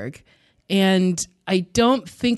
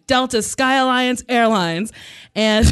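ordinary talk, nothing marked

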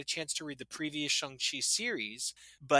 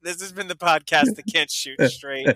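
laughing; 2 semitones higher, 11 dB louder than usual. Real, not acted. Cracking up over own mistake.

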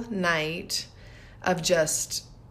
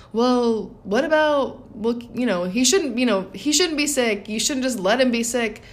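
higher, insistent mock protest; objecting skeptic; 5 semitones higher, 3 dB louder than usual. Mother voicing people who object to acceptance, mild mock protest. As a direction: push back like a doubtful listener. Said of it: "they think".

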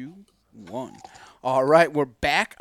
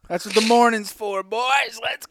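hype shout; 6 semitones higher, 5 dB louder than usual. Real, not acted. Hyping up the crew, shouting.

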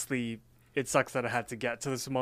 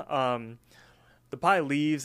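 ordinary talk, nothing marked

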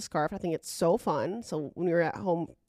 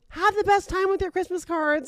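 high, louder called-out well-wishing; nice, cheery send-off; 10 semitones higher, 5 dB louder than usual. Retold or imitated. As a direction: imitate a friendly stranger calling goodbye. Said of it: "he was, like, so nice".

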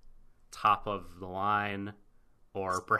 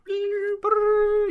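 imitated spilling rings; 10 dB louder than usual.

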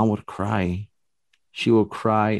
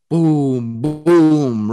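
two heavy booms, the second higher and louder; imitated loud knocks; 5 semitones higher, 6 dB louder than usual. The wall answering back, two loud mock knocks. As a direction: echo the ghost knocking back twice, hard. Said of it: "real loud".

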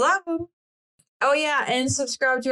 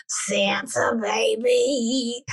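slow, whispered smoky rasp; smoker's voice; much slower than usual. Pretend. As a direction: breathe it out like a chain smoker. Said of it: "Please do [name] in your smoker's voice".

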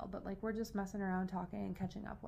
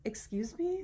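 retold indignant question, voice lifting; offended; 3 semitones higher than usual. Telling co-host about friends laughing at her, offended. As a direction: act out being affronted by friends. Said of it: "offended that they're laughing".

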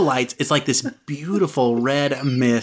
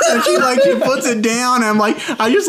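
normal talking voice

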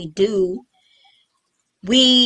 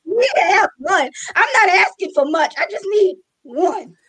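mock pleading; 7 semitones higher than usual. Real, not acted. Friend to friend about wanting one more child, mock pleading. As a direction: beg for it, half joking.

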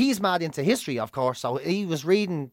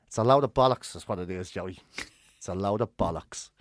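deadpan film quote; 9 semitones lower, 5 dB quieter than usual. Retold or imitated. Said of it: "Jimmy Rabbit goes".

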